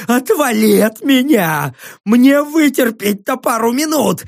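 high, drawn-out cries through pain; agonised defiance. Acted. Dying prisoner defies his torturer, wailing. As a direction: curse him in a high, pained wail.

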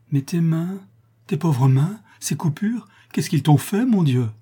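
dismayed over a loved one's wounds; alarmed concern. Acted. Woman finds her lover's hands cut, alarmed concern.

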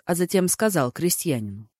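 ordinary delivery, nothing marked

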